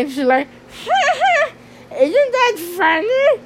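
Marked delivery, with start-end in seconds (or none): 0.8-1.5 much higher imitated giggle; high mock giggle. Friend laughing at her meme, high mock giggle. 2.0-3.4 much higher, giddy friend voice; high mock friend. Friend sending a weird meme, giddy high voice.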